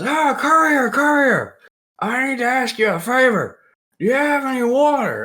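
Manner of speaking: high, loud put-on holler; hailing courier character. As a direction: call out to the courier in a high mock voice. Retold courier calling out at gate.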